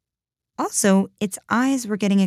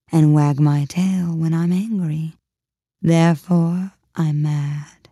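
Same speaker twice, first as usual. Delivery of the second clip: slow, louder, drawn-out cat voice; the Cheshire Cat explaining its logic, louder and slow; grinning cat, pleased with itself; 4 dB louder, slower than usual. Acted. The Cheshire Cat reasoning with Alice, smug and slow. The cat's held voice.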